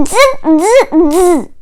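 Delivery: 1 monkey chatter. Acted. Monkeys mock the peddler.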